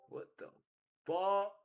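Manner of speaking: loud, high shocked outburst. Real, not acted. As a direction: blurt it out in disbelief. Reacts to a big trade.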